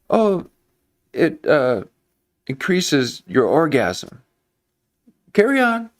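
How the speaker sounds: high, breezy mock-nurse brush-off; lightly hammed-up sneaky nurse; sly nurse. Nurse voiced in the story.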